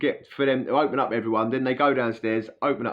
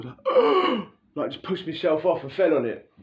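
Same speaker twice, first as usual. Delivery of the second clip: gasping fall; 7 semitones higher, 3 dB louder, slower than usual. Retold or imitated. Gasp while falling onto bed.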